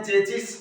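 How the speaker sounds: चेसिस said "CHE-chis"; common mispronunciation. Shown as a demonstration. Teacher showing students the common error, mispronounced "che-chis". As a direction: say चेसिस with two ch sounds. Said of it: "गलत करते हैं".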